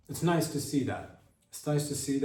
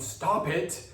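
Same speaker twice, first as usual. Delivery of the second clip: mock yell; 7 semitones higher, 3 dB louder than usual. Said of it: "I yelled".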